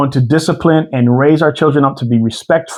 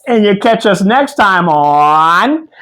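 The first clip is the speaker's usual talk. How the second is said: show sign-off; 5 semitones higher, slower than usual. Acted.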